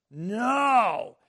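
sudden loud high no; mock defensive outcry; cry of protest. Shown as a demonstration. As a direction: shout it out in defense. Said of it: "we wanna just cry out in defense".

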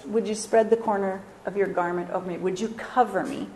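softly acted, understated plea; quiet, lightly acted asking; soft request. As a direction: drop the volume for a gentle proposal. Voicing Ruth's timid proposal to Boaz.

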